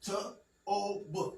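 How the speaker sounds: "Ta'ob" in three separate sounds: ta, o, b; segmented teaching pronunciation of "Ta'ob"; sounded out. Shown as a demonstration. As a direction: say "Ta'ob" piece by piece. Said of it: "You just need to know the sounds".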